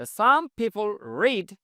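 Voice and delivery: very slow, word by word; spaced-out classroom read; slow model reading. Shown as a demonstration. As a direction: dictate each English word slowly for learners. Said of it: "slow model sentence".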